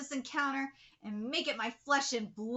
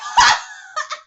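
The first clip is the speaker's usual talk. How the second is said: high burst of laughter; relieved cackle after the match; loud laugh; 13 semitones higher, 16 dB louder than usual. Real, not acted.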